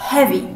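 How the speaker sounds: "heavy" with a short e, like "hevvy"; short, quick first vowel in "heavy"; right pronunciation. Shown as a demonstration. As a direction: say "heavy" to rhyme with "bevy". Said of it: "The right pronunciation is".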